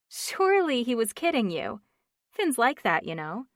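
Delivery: lightly voiced chatty friend; flustered friend on the phone; Stephanie, chatty friend.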